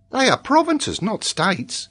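high-pitched schoolboy correcting; know-it-all little pupil; confident child. Acted. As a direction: state it surely in a high child's voice.

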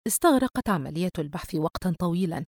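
ordinary delivery, nothing marked

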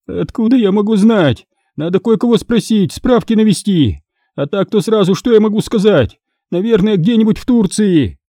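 high-pitched, flustered excuses; flustered captive woman. Acted. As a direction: stall the interrogators in a high voice.

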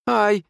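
high, loud little yelp; pained cry. A narrator's reading. Wounded nurse in the dark, crying out in pain. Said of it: "донеслось из темноты".

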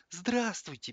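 high squeaked greeting; Radzinsky. A narrator's reading. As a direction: peep it in a tiny high voice. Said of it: "пискнул Радзинский". (тоненько пискнув)

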